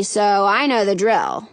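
ordinary delivery

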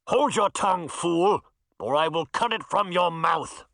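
menacing villain warning; threatening knight. Acted. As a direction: threaten him coldly.

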